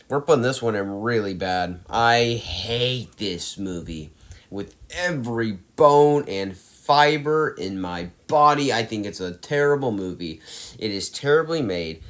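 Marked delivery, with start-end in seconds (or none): 1.9-8.7 every word dragged out slowly, body punched; drawn-out, deliberate loathing; slow emphatic hate. Real, not acted. Ranting about a hated movie.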